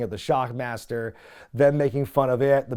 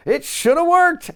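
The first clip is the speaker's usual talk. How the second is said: much higher, louder, slurred drawling lament; Dusty impression; 8 dB louder than usual. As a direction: whine it to the camera like a heartbroken old promoter. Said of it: "Dusty looks at the camera and goes".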